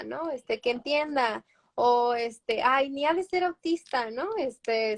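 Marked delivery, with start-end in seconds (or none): 2.6-4.1 a light imitation of someone brushing off a diagnosis; mock dismissive relative. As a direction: toss it off lightly, imitating a doubter. (imitando a un pariente que minimiza el diagnóstico, en tono despectivo)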